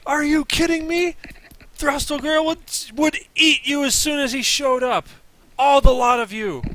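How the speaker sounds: high, loud mocking scorn; scornful lizard folk. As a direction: sneer at the rival tribe. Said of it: "and they said".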